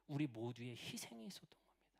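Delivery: soft breathy whisper of the answer. Acted. Preacher answering his own question in a whisper. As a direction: drop to a whisper to land it.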